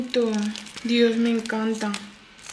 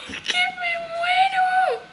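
very high, drawn-out wail of delight; overwhelmed squeal; much slower than usual. Real, not acted. Overwhelmed by a follower's present, high delighted wail. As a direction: squeal it slowly, overcome with joy.

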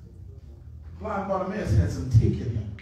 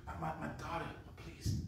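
much softer, faltering plea; pleading mother; 3 semitones lower, 15 dB quieter than usual. Acted. Mother begging Jesus for her daughter, soft faltering plea. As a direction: beg quietly, stumbling.

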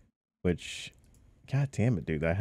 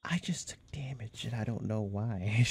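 whispering; 2 semitones higher, 8 dB quieter than usual. Real, not acted. Sudden unexplained damage.

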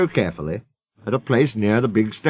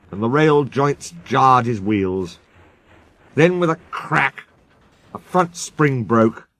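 plain reading voice

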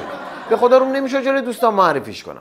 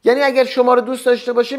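ordinary delivery

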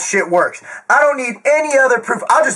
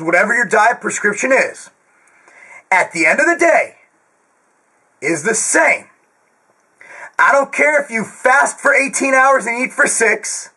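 ordinary talk

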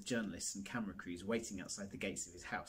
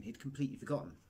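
usual speaking voice

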